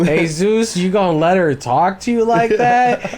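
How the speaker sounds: high, loud gleeful taunting; mock-outraged coworker goading the boss; egging on. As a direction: stir the pot like a gleeful instigator.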